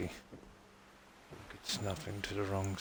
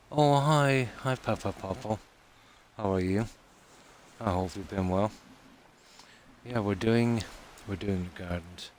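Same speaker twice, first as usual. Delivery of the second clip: talking around a cigarette in the mouth; 6 dB louder than usual. Real, not acted. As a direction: cigarette held between lips while speaking. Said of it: "I've been talking with a cigarette in my mouth".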